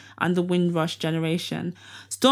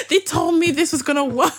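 high, lightly acted aggrieved defendant; mock complaint; 8 semitones higher, 4 dB louder than usual. Host voices Weinstein after the verdict, mock aggrieved. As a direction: complain as if let down, played for laughs. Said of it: "He's like".

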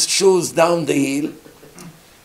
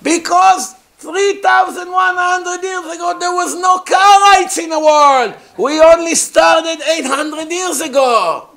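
much higher, loud blustering yell; indignant shout; 13 semitones higher, 7 dB louder than usual. Acted. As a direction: shout it out, blind to your own blunder. Karaite shouts his self-defeating answer.

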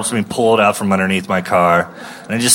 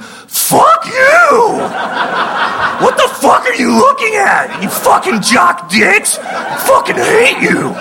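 high shrieking furious rant half laughing; nerd rage screaming. Retold or imitated. Comic reenacting screaming at jocks from his car in nerd rage. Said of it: "nerd rage came flashing back ... went".

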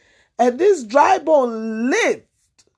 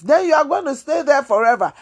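ordinary talk, nothing marked

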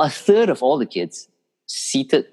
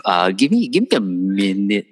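ordinary talk, nothing marked

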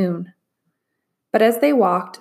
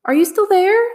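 higher, louder plaintive call; 12 semitones higher, 6 dB louder than usual. A narrator's reading. Trapped voice calling to Min Lee, plaintive. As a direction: plead into the dark. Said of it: "the voice asked plaintively".

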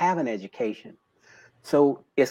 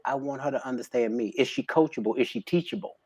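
usual speaking voice

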